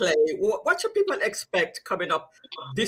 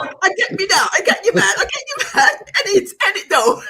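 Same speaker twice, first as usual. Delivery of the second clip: laughing mock London accent, higher and louder; laughing London slang; 9 semitones higher, 6 dB louder than usual. Real, not acted. Host laughing back at guest in mock London slang. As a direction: giggle through the London slang. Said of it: "In it, in it, mate".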